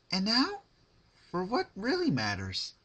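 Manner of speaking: high, bouncy cartoon pony voice; giddy Pinkie voice. Pinkie gleefully announcing the next cut, high and giddy. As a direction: chirp it out with sugary glee.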